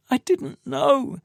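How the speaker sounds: high, slow broken denial; anguished denial. Acted. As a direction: drag it out in despair. Tamsin crying, denying.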